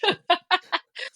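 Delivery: real laugh. Real, not acted. Laughing after retelling.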